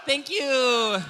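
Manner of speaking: milk the applause like a showgirl; hammed-up diva gratitude; grand diva thanks. Diva thanking crowd for tips.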